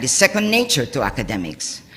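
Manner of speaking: normal talking voice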